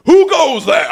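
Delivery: put-on Who goes there said high and loud in Middle English accent. Plain Who goes there retold, then high Middle English accent. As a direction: boom Who goes there like a medieval guard. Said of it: "a perfect Middle English accent".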